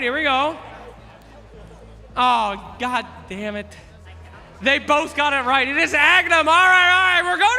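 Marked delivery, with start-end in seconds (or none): 5.7-7.6 loud announcement. Real, not acted. Host announces correct answer.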